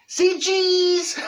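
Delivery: high, loud say cheese; bright camera call; photographer's call. Retold or imitated. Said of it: "And he's going".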